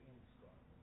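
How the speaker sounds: very soft read-out under the breath; barely audible reading; reading a message. Real, not acted. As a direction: read the question quietly to yourself. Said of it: "let me read what you just said".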